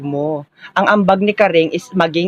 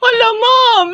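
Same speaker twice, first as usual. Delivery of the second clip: high, loud comic crying shout; mock wailing; 4 dB louder, slower than usual. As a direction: bawl like a spoiled kid in trouble. Teacher calls her parents. (eksaheradong iyak na sigaw)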